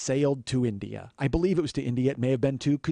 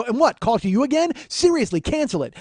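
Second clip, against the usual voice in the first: higher, louder incredulous demand; exasperated; 9 semitones higher, 4 dB louder than usual. Retold or imitated. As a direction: push her to just cancel it. Arguing with the agent.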